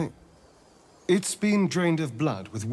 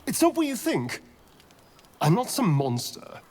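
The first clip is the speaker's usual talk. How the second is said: higher, panicked protest; startled pleading; 14 semitones higher, 5 dB quieter than usual. Acted. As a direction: plead in alarm, voice jumping up. Vampire caught, pleading, afraid.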